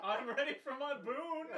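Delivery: hammed-up eager Nuala. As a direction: demand the boon gleefully. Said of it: "she's like".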